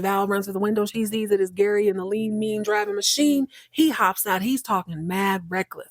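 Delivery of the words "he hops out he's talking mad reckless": usual speaking voice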